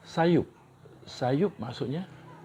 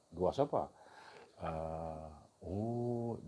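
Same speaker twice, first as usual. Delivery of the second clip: usual speaking voice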